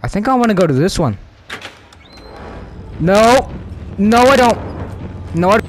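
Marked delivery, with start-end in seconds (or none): 3.0-5.6 sudden alarmed protest; panicked refusal. Real, not acted. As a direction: cry out and back away in fright. Regrets entering, backing out.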